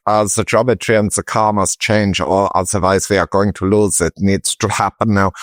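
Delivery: put-on Austrian-German accent, pitched a little up; Niki Lauda. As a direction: imitate a fussy racing driver's German accent. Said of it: "And Niki Lauda's like".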